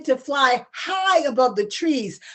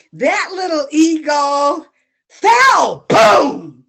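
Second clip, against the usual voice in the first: storyteller shouts the fall to the audience; dramatic shout; 3 semitones higher, 7 dB louder, much slower than usual. A narrator's reading. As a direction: land "fell" with a loud cry. Dramatic climax again.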